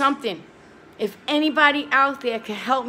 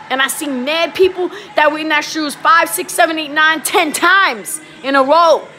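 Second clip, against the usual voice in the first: loud, higher, slowing at the end; real anger; 4 semitones higher, 7 dB louder than usual. Real, not acted. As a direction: pour out real frustration, loud. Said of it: "I'm mad".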